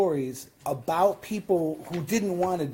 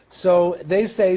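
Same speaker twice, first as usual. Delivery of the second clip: ordinary talk, nothing marked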